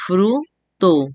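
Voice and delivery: "fruto" in two beats, FRU, TO; split into syllables. Shown as a demonstration. Teacher giving a two-syllable example, "fruto" broken in two. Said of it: "dos sílabas".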